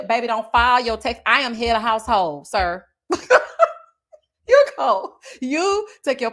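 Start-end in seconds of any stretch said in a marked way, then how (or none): 3.1-3.8 high, loud laugh; real laugh. Real, not acted. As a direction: burst out laughing. Laughing at her own joke.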